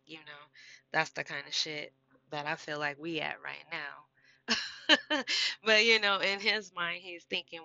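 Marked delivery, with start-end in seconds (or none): 4.5-5.6 high, loud burst of laughter; laughing. Real, not acted. Laughs at own threat.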